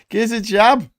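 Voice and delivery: high, loud Scouse catchphrase; Yozza Hughes. Acted. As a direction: push the accent hard, 'jab' for 'job'. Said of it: "says Yozza Hughes".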